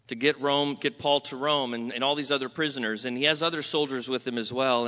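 normal talking voice

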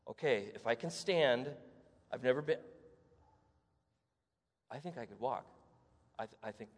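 soft, low, trailing disbelief; hushed wonder. Acted. The healed man taking his first steps, hushed and awed. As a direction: murmur it as if afraid to break the moment.

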